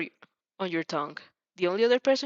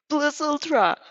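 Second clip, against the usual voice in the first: high, loud heroic shout; heroic cry; 7 semitones higher, 6 dB louder, slower than usual. Acted. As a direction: fists up, yell the motto. Boy shouts hero motto.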